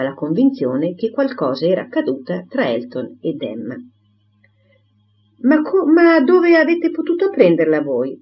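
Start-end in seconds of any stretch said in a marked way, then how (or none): 5.4-8.1 higher, flustered exclamation; a chattering spinster caught off guard; breathless surprised question; exclaiming Miss Bates. Acted. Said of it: "Esclamò Miss Bates".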